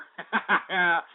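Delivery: staccato cackle; rapid gloating laugh; gleeful laughter. Real, not acted. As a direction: laugh in triumph after the whoop. Celebrates riling the caller.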